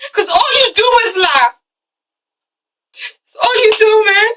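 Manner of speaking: high, loud hammed-up crying accusation; tearful mock shout. Boyfriend accusing girlfriend of lying, tearful mock shout. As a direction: wail it out, overplaying the heartbreak. Acted quarrel over a lie.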